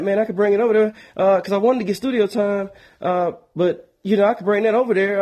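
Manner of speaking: a chatty friend who wants to come over; eager friend calling. Retold or imitated. Caller voiced in phone story.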